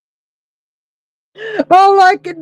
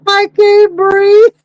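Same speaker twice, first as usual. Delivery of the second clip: mock breathless laughing; 6 semitones higher, 4 dB louder than usual. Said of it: "I'm laughing so hard".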